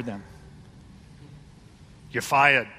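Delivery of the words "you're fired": mock boss firing someone, lower and louder; Donald Trump. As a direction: bark it like a TV boss, half-joking. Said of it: "I keep coming back to the Donald".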